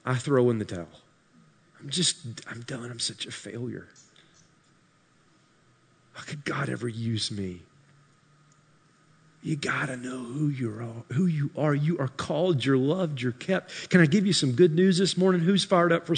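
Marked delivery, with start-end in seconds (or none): 0.0-7.6 much softer, low defeated murmur; defeated giving up. Retold or imitated. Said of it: "to get you to say". 9.4-13.9 soft reassurance. Acted. Tender reassurance.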